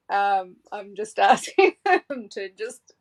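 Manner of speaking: real chuckle caught mid-sentence; laughing. Real, not acted. Psychic asking the yelling guides to ease off, laughing. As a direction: keep talking as a laugh slips out. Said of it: "I'm laughing because".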